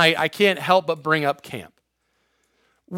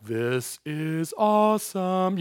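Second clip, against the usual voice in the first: slow, stretched-out, softer marvelling; mock awestruck wonder; 6 dB quieter, much slower than usual. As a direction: draw out each word as a wonderstruck Peter. Peter marvels while walking on water.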